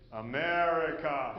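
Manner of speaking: "America" drawn out slowly with a knowing fall; knowing repeat. Retold or imitated. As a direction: say "America" as if it explains everything. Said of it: "And he says".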